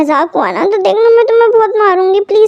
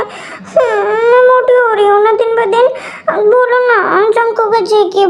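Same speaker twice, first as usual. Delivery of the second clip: whiny crying; 3 semitones higher than usual. Acted. Pregnant wife whines about weight.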